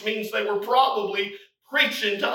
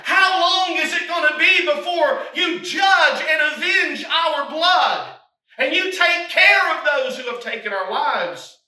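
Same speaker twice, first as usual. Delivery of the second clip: raised, louder cry, slowing on avenge our blood; 6 semitones higher, 4 dB louder than usual. Acted. Preacher voicing saints crying for vengeance, loud plea. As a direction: plead for justice. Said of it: "these saints were crying".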